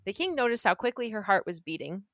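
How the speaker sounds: ordinary delivery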